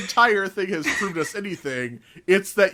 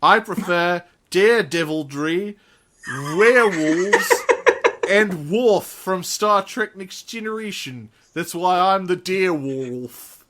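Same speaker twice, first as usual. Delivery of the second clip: put-on slow New Zealand accent, laughing; 2 semitones higher, 3 dB louder, slower than usual. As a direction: drawl it in a Kiwi accent. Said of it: "I was just doing a New Zealand accent".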